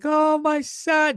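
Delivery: high, slow, hammed-up gasp of a parent; mock worried parent. Doting parent fretting over her son, high and slow. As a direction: wail it like a doting mom. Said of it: "You're like".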